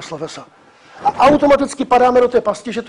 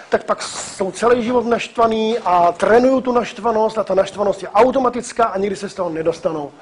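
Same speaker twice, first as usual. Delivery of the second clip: usual speaking voice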